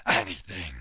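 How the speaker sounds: very deep doubtful dog voice; deep wary beast question; low hound. Acted. Cornered hound echoes the demand, deep and wary.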